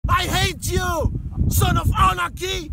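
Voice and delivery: slow, loud, high hammed-up insults; mock angry yelling. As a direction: yell insults in a drawn-out rant. Mock insults yelled in English.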